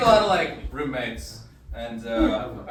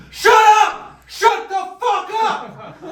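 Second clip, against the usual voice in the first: much higher and louder furious yell, slowing down; yelling at smoke detector; 12 dB louder, much slower than usual. Retold or imitated. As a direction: scream at the beeping alarm. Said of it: "and you're like".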